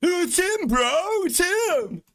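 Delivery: put-on high, squeaky kid voice, slow on the second line; mock the excited kid who recognizes him; high-pitched imitation of a fan; excited gamer. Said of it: "they'll be like".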